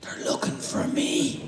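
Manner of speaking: high, slow, unvoiced whisper; child's whisper. Hiding child whispers why everyone is in the house. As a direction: let the punchline creep out.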